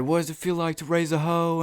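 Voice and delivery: high-pitched mock yell; retold yelling. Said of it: "my friends are yelling at her stepdad".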